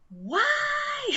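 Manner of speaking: high, loud yelled "Why?"; mock angry shout. Imitating the angry customer shouting at the clerk. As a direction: throw it out like an outraged customer. Customer yelling at retail worker.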